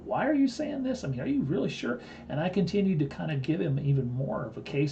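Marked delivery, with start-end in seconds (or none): 0.0-2.0 much higher, incredulous question; imitating a baffled investor; puzzled disbelief. Investor Rich questions him.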